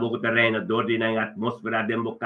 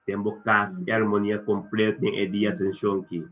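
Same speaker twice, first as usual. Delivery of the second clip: usual speaking voice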